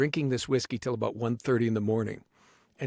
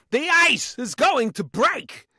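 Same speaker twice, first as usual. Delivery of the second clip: loud, high, slow drawn-out delivery; Christopher Walken impression; 11 semitones higher, 6 dB louder, much slower than usual. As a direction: ring out each key word high and slow. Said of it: "tell me about this Walken thing".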